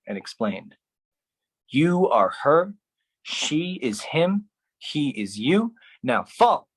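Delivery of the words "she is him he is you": normal talking voice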